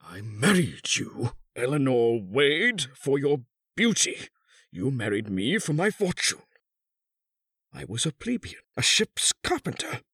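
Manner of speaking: high, deliberate recital swelling to an outburst; bitter accusation. A narrator's reading. Husband recounts the bought marriage, bitterly accusing. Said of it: "The old man continued".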